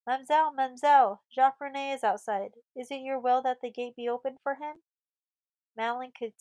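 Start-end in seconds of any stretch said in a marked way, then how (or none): 0.1-4.8 loud, high, urgent hail; calling out. A narrator's reading. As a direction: call up to the young mistress. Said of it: "the old man called out".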